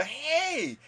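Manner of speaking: high, loud call from a car window; excited fan hollering praise from a car. Retold or imitated. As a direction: shout up to a celebrity from the car. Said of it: "stick the head out and go".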